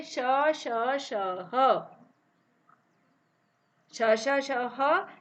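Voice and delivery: श and ह drawn out with breath; breathy letter demo; hissed letters. Shown as a demonstration. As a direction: let the air hiss through श. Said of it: "जब हम इसको बोलते हैं".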